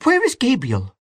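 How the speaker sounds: high-pitched, mildly frantic call; despairing cry. A narrator's reading. Aunt Kate hunting for Gabriel, high despairing cry. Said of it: "she cried".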